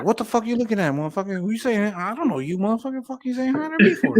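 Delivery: high-pitched mock outrage, hammed-up; rude New Yorker. New Yorker answering a friendly hello, mock hostile outburst. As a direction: play a hostile New Yorker snapping back. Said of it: "They'd be like".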